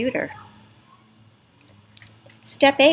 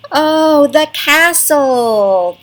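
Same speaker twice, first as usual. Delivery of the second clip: much higher, louder, slow oh the castle; exaggerated realization; mock discovery; 8 semitones higher, 8 dB louder, much slower than usual. Shown as a demonstration. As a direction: act delighted at figuring it out. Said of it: "Can you help me with that?".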